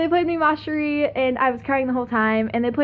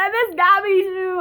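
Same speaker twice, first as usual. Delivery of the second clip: higher, whiny-fond outburst; gushing longing; 7 semitones higher, 3 dB louder than usual. Real, not acted. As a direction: burst out missing a friend. Sudden longing for friend.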